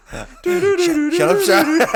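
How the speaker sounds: high squealing laugh through words; laughing shout. Real, not acted. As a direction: shriek it while cracking up. Laughing outburst at friend.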